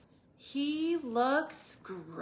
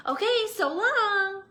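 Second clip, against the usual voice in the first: much higher, louder breezy goodbye; cheerful; 10 semitones higher, 8 dB louder than usual. Acted. Tumbleweed cheerfully saying goodbye to Hank. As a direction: sing out a carefree farewell. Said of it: "said Rosie cheerfully".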